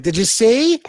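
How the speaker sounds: high, loud gossipy impression; Angel. Viewers gossiping later about what he said, in a high imitation. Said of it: "I'm doing Angel's voice".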